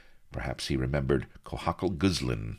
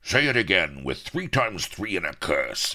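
high, loud rustic roar; boisterous buccaneer shout; bellowing pirate; 7 semitones higher, 6 dB louder than usual. A narrator's reading. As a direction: bellow approval like a rowdy sea dog. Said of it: "bellowed Firebeard".

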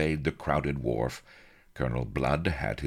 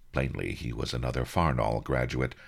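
usual speaking voice

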